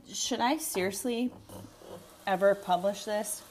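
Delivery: ordinary talk, nothing marked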